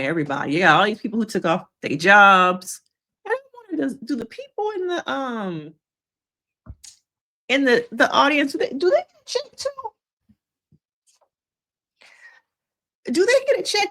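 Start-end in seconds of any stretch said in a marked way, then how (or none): 3.2-13.9 comic disbelief. Real, not acted. Joking about paid audience.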